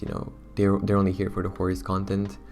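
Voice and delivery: usual speaking voice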